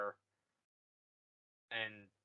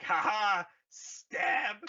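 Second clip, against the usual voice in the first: higher, louder gleeful cackle; gleeful mock stab; 7 semitones higher, 5 dB louder, much slower than usual. Rey stabbing Kylo, gleeful mock taunt. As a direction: taunt him with glee as you strike. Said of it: "Rey's like".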